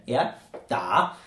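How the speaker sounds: da said low in the third tone, dipping; da as a slow low dip. Shown as a demonstration. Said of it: "third tone".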